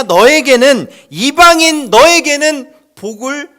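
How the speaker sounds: 이방인 너에게는 stressed loud and slow; emphatic. Real, not acted. Preaching emphasis. (너에게는을 힘주어 강조)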